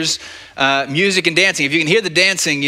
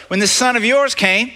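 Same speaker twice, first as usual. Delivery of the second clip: indignant whine; 8 semitones higher, 3 dB louder than usual. Retold or imitated. Older son complaining to father.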